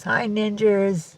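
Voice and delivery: high, drawn-out cutesy greeting; voicing the cat. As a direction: sing out a slow hello for your pet. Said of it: "Cat says".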